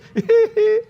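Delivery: high squealing mock laugh burst; a sudden high hoot of a laugh; hammed-up high laugh; imitating Keith's laugh. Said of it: "I like the way Keith laughed".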